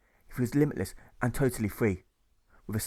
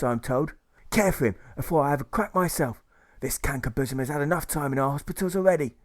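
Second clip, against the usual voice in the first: a louder, higher barking officer giving orders; commanding marshal; 3 semitones higher, 4 dB louder than usual. Acted. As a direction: order everyone about like an irate provost marshal.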